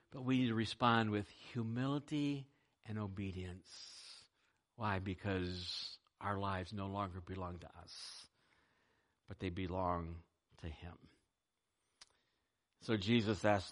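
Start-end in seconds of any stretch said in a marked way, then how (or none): none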